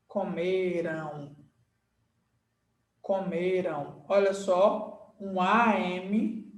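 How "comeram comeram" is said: "comeram" slowly, ending in a nasal ão; pronunciation demo. Shown as a demonstration. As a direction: draw out "comeram" to show its final glide. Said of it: "Se você fala assim".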